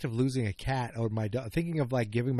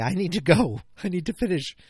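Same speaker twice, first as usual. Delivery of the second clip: insistent quote; 6 semitones higher, 6 dB louder than usual. Retold or imitated. Retelling telling wife he must go.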